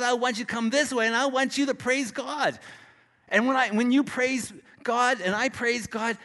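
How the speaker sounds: high, driving sermon voice; fervent preaching. Acted. Preacher urging the congregation to use their gift, fervent.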